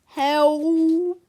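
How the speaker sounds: loud, high, rising squeal; drawn-out wail of alarm. A narrator's reading. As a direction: squeal for help in fright. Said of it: "squealed Mister Nurden".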